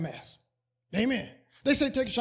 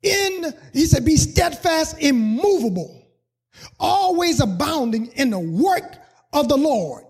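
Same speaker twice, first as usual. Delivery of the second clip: loud, high, stretched preaching of the verse; drawn-out loud scripture, much louder and higher; preached scripture reading; 3 semitones higher, 7 dB louder, slower than usual. Acted. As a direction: proclaim each word to the church.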